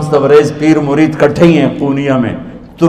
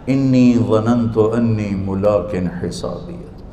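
slow, soft Arabic recitation; quiet measured tilawat; Quranic recitation; 5 semitones lower, 7 dB quieter than usual. Shown as a demonstration. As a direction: recite the verse slowly and reverently.

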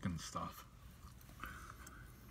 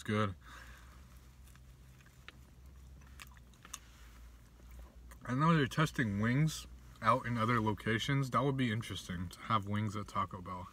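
eating between words; talking while chewing; 3 dB quieter than usual. Real, not acted. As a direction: mouth partly full. Seen 0: Took a bite at -4; hand and food stay at his mouth throughout.

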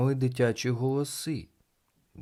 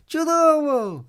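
loud, very high gleeful shout; delighted exclamation; 9 dB louder, slower than usual. A narrator's reading. Children cheering the failed shots, loud high cheer. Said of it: "вигукували вони".

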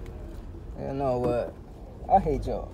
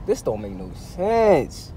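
ordinary talk

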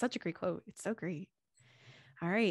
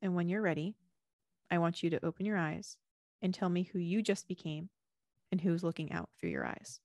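a hint of meditation guide voice; guided visualization. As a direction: lead them gently inward with closed eyes. Leads a closing visualization.

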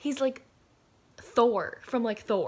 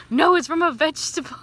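exasperated Meredith; 8 semitones higher, 10 dB louder than usual. Retold or imitated. Meredith correcting, high loud.

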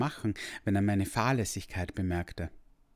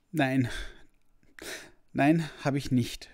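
higher, louder tearful no; sniffling; 6 semitones higher, 4 dB louder than usual. Acted. The boy answers the dragon sniffling and tearful. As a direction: blurt it out while sniffling. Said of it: "schniefte ich".

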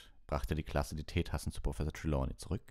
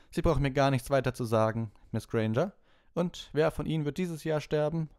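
higher, louder, dry teacher voice; a higher, louder stern schoolmistress; stern professor; 11 semitones higher, 5 dB louder than usual. A narrator's reading. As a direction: cut her short as the weary professor. Professor answers Hermine.

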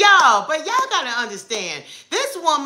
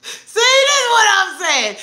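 excited exclaim; 10 semitones higher, 5 dB louder than usual. Real, not acted. Playful mock complaint to viewers.

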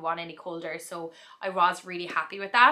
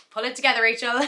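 self pep talk; 4 semitones higher, 6 dB louder, faster than usual. Retold or imitated. Telling herself not to cry.

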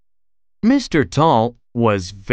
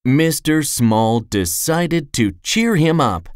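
usual speaking voice

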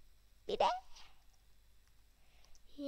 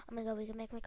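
normal talking voice

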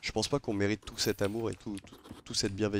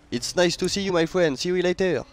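loud, high friendly call; 8 semitones higher, 6 dB louder than usual. Real, not acted. Streamer hailing another pirate crew, loud friendly call. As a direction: hail them across the water. Calling out to other players.

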